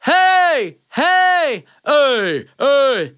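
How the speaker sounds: powerful high yell on full lungs; full-breath yell. Shown as a demonstration. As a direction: fill up and belt out "hey". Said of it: "if I take that breath and fill it up".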